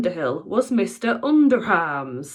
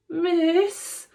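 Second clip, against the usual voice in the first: stammering; 10 semitones higher than usual. A narrator's reading. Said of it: "he stammered".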